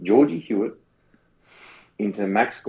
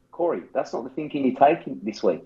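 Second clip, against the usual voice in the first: ordinary talk, nothing marked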